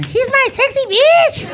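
high squealing wife voice, slow and laughing; mock wife squeal; wife's voice. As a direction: squeal it like his adoring wife. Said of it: "His wife calls him Sexy".

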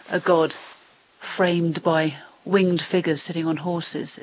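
ordinary delivery

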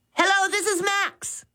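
high, quick, eager hello; a boy grabbing the phone too keenly; eager blurt. Acted. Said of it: "he blurted eagerly".

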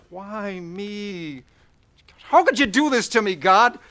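anguished plea. Acted. Crying out to God after crash.

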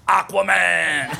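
high, drawn-out superhero cry; proud mock-heroic holler; superhero shout. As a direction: announce it like a cartoon hero, stretched out. Shouting a hero name as his own.

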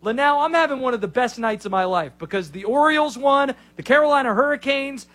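high, emphatic mock-rant voice; punchy impression, pitched high with pauses between bursts. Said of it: "I'm gonna do my best [name] impression".

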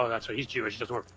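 ordinary talk, nothing marked

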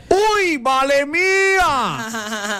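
high, drawn-out sound-system drop; Mike Char impression. Host demonstrates sound-system voice drops, Mike Char impression. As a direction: stretch it high like a picó jingle voice. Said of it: "a ti te salió muy bien".

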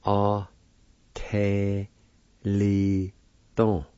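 "A te li don" in isolated syllables, long pauses. Shown as a demonstration. Teacher pronouncing the word plate, "A te li don" in separate syllables. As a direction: say "A te li don" one syllable at a time. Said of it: "is pronounced like this".

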